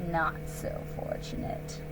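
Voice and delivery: soft, low threatening hush; menacing whisper. Acted. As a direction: let the threat sink in quietly. Wolf's veiled threat.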